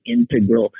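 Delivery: "integral" as in maths, IN-tuh-grul; first-syllable stress. Shown as a demonstration. Citing the math term, "integral" stressed on IN. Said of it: "one is math related".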